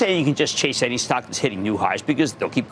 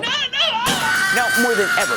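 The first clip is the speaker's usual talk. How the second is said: emphatic shout; much slower than usual. Acted. TV host hyping his viewers, shouting. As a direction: bellow it like a hype man.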